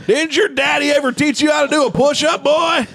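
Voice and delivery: drill sergeant. Pretend. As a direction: bark it at a weak recruit. Coach yelling at kid.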